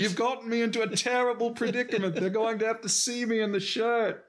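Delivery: high, hammed-up British accent; mock-distressed British accent; Russell Brand. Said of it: "just the accent makes everything funny".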